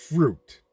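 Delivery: fruit thrown out high and loud as the punchline; pointed stress on fruit. Shown as a demonstration. Reader springing the pun on his audience, stressing fruit. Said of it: "Get it?".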